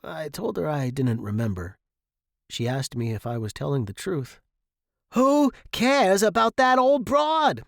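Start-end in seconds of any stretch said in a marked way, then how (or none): 5.1-7.6 high, loud, slow bellow; furious dad shouting down the family; father's scream. A narrator's reading. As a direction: scream it in exasperation. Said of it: "my father screamed".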